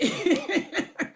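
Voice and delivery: short pained laugh, rising; bitter laugh. Real, not acted. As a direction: laugh through the pain. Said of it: "I'm laughing".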